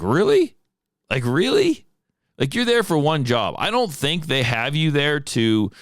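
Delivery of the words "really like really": high, mocking "really"; incredulous. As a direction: throw back a scornful disbelief. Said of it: "I'm like".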